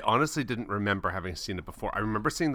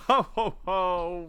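Santa ho ho ho; 7 semitones higher, 3 dB louder than usual. Acted. Santa laugh greeting.

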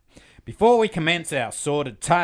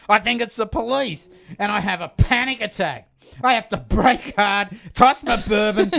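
higher, mounting exasperated rant; hammed-up panic, pitched up; mock panic; 7 semitones higher than usual. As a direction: build into a flustered, comic tirade. Acting out panicked listener post.

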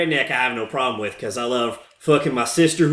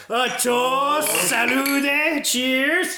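mock toast; 9 semitones higher, much slower than usual. Raises a toast.

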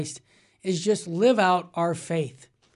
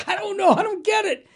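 much higher, bewildered voice; baffled young man; 13 semitones higher than usual. Retold or imitated. Young man answering the waiter, high and baffled. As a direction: play him lost and helpless. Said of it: "And he's like".

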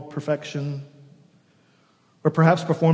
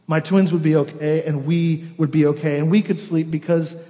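ordinary talk

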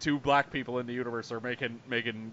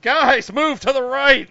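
much higher, louder hammed-up calls; mock pilot orders; 11 semitones higher, 9 dB louder, slower than usual. Mocking Oscar Isaac's pilot lines, hammed-up shouting. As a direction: shout directions like a pilot on comms. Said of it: "saying stuff like".

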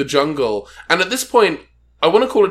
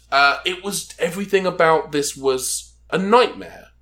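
ordinary talk, nothing marked

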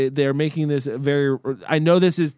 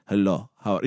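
low, softer fake Russian accent; mock Russian accent; 10 semitones lower, 4 dB quieter than usual. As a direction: put on a movie-Russian accent, pitched down. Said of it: "like, an accent".

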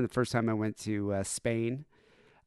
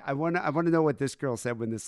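usual speaking voice

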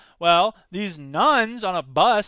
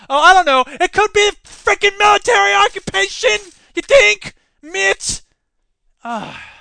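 loud, high sarcastic rant; sneering shout, much higher and louder; sarcastic outburst; 13 semitones higher, 10 dB louder than usual. Real, not acted. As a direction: yell mockingly at Mitt.

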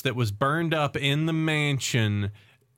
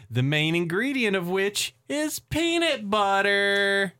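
dramatic story narration; 5 semitones higher than usual. Acted. Telling co-hosts about the magical formula, dramatic narration. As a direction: stretch it out and build to the big reveal. Reads the plot recap dramatically.

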